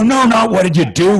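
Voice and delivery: high, loud mock-exasperated outburst; exasperated correction. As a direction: re-enact a dad cutting in, voice shooting up. Dad exasperated, correcting son, retold.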